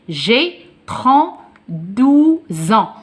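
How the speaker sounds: "douze ans" in slow, separated pieces, "zans"; liaison in Z. Shown as a demonstration. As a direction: draw out each piece, a z opening "ans". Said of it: "X devient Z avec on". (liaison en Z, « douze ans » très lentement découpé)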